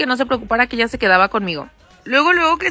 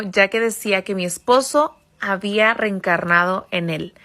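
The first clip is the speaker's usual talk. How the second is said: usual speaking voice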